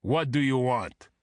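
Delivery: put-on thick Russian-accented demand. A narrator's reading. Bodyguard questioning agents at the door, thick Russian accent. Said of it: "in the same thick accent".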